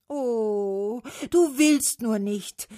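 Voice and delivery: high, loud, drawn-out complaint; lamenting. A narrator's reading. Trees accusing Autumn of refusing, lamenting. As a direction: wail it reproachfully, stretching the words. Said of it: "klagten die Bäume".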